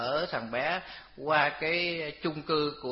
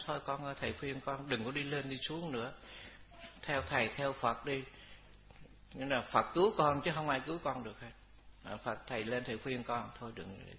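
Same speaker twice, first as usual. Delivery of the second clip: gentle coaxing; 7 dB quieter than usual. Retold or imitated. Monk gently coaxing the ghost.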